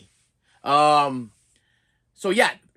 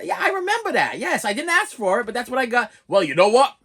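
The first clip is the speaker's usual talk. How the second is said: ordinary talk, nothing marked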